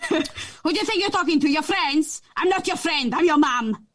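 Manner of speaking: mock-stern Portuguese-accented mum; mum's scary accent. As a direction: imitate a Portuguese mum scolding, accent and all. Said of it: "that's a scary mom accent".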